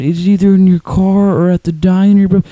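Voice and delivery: slow, high, drawn-out mimicry; high put-on voice, laboriously stretched words; Tim impression. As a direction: imitate a simple phone message, very slowly. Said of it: "the longest fucking simple message".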